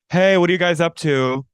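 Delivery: high, loud bright-voiced greeting as Lisa; imitating Lisa. Cohost mimics Lisa walking in on the boys, high and loud. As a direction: breeze in like Lisa greeting the room. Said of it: "first she walks in and she goes".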